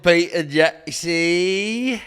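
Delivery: put-on slow drawn-out New Zealand accent. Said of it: "that was a New Zealand accent".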